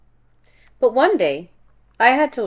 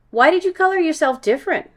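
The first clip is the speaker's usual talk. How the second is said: high, fast, louder curious question; curious child; 7 semitones higher, 4 dB louder, faster than usual. A narrator's reading. Kid at the back calling out, high and fast.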